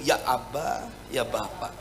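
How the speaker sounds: hushed cry to the Father. Shown as a demonstration. Pastor showing the spirit's inner cry, very low and hushed. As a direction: murmur "Ya Abba, ya Bapa" low and soft, as if praying alone. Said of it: "roh itu berseru".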